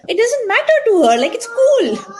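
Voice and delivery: high, loud, delighted exclamation; retold happy realisation. Acts out her happy realisation.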